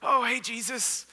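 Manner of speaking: lightly put-on casual greeting, drawn out; acting cool. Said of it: "they're acting cool".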